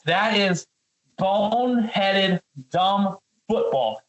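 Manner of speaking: slow, scornful emphasis. Real, not acted. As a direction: draw out boneheaded and dumb slowly. Angry rant at the coordinator.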